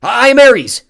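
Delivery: war god's furious proclamation; insistent roar. A narrator's reading. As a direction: shout it high and very loud. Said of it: "he insisted".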